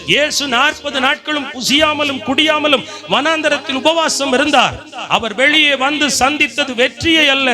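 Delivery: high, driving sermon delivery; impassioned preaching. Real, not acted. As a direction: challenge the congregation with ringing questions. Preaches at full force.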